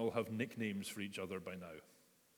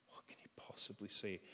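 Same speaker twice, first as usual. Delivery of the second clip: soft whispered question; soft whispered, anxious question; dreading whisper; 9 dB quieter than usual. Acted. Peter dreads the conversation.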